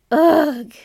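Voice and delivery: disgusted shuddering ugh; shivering ugh. A narrator's reading. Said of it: "with a shiver".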